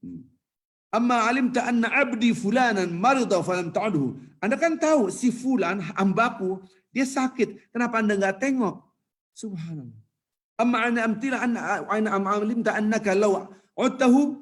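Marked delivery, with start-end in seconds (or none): 0.9-8.8 high-pitched, stretched-out reproach; God reproaching servant. Acted. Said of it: "apa kata-kata Allah?". 10.6-14.3 high, ringing Arabic declamation; God's reply recited. Acted. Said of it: "apa kata-kata Allah?".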